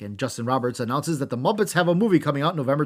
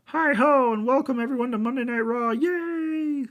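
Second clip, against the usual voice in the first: high, thin Kermit the Frog voice, much higher; Kermit impression; 11 semitones higher than usual. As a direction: do Kermit the Frog welcoming the crowd. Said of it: "That was a pretty good Kermit".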